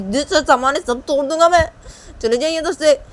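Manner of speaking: angry threat. Acted. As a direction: threaten loudly in a temper. बेटा तोड़फोड़ की धमकी देता है. (गुस्से में ज़ोरदार धमकी)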